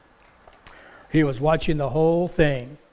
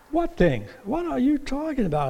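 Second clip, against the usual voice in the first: higher, wide-eyed questions, softer; feigned innocence; 9 semitones higher, 3 dB quieter than usual. Retold or imitated. Jesus plays dumb with the disciples, mock innocent. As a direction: pretend not to know. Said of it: "As if he didn't know".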